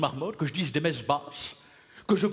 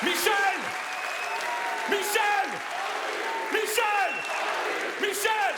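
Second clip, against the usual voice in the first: calling out; slower than usual. Acted. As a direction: call the name across a hall. Calling out his own name.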